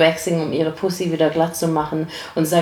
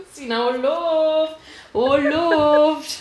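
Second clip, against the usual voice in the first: laughing; 9 semitones higher, much slower than usual. Real, not acted.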